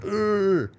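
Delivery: a sharp high ugh, pulling away; imitating a partner who freaks out at a need; mock disgusted groan. Partner recoils from a stated need, mock disgusted ugh. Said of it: "they're freaking out".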